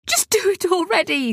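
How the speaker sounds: can't stand the waiting; tense impatient boy. Acted. Arthur can't bear suspense.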